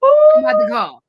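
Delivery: put-on a kid in a panic about the call; panicked. Frantic babysitter about to phone mom.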